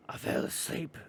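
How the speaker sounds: voiceless, slow rasp of a possessing spirit; old man demonic voice. Retold or imitated. Movie ghost telling how he died, in creepy old-man rasp. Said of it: "he goes and says".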